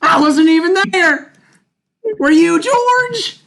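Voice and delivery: high, loud, stretched fan squeal; screaming excitement. Pretend. Hyped fake excitement.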